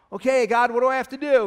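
calling up to God. Acted. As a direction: call up to someone far above you. Hanging man calls up to God.

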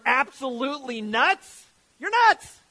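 high, loud outburst of disbelief; exaggerated, jeering yell; mock accusation. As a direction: shout it as a hammed-up heckler. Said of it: "Do you know what they're saying there?".

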